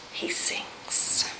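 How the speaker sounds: breathy whispered drop. Acted. Preacher whispers to the congregation as Peter sinks. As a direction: let the words sink into a whisper. Sermon climax, Peter sinking.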